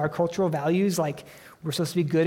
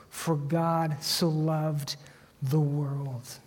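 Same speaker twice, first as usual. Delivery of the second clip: slow tender reading; much slower than usual. Shown as a demonstration. Pastor offering the verse as dawn to the congregation, slow and hushed. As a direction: hold every word like first light after a dark night. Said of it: "what is the ray of light".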